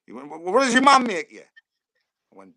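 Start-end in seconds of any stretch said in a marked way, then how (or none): 0.3-1.4 loud, high-pitched question; exasperated gaffer. Retold or imitated. Manager asking player about his meal, loudly exasperated. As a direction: bellow it at a clueless youngster.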